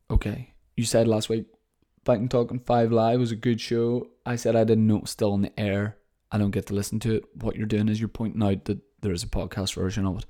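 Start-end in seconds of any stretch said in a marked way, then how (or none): none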